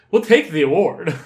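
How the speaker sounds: loud, cheeky, laughing; loud mock acceptance. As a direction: blurt it out cheekily. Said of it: "it's like".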